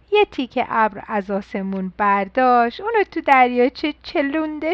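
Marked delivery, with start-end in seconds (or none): none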